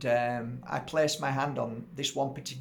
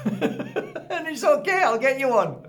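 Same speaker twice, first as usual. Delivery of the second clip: much higher, slow and laughing through it; 12 semitones higher, 7 dB louder, slower than usual. Retold or imitated. Healer promising the woman a new crystal, high and laughing. Laughing at his own thought.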